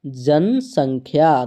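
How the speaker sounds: जनसंख्या drawn out slowly with a falling tune; teacher spelling out the Hindi meaning; slow answer. Shown as a demonstration. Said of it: "क्या आता है".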